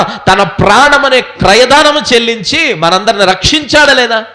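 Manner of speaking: preacher driving on without letting up; impassioned preaching. Real, not acted. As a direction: press each rhetorical question on the congregation. Preaches Christ's sacrifice.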